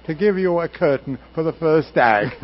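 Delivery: put-on loud, high British stage accent; British actor complaining in a booming voice. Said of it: "in his very British accent".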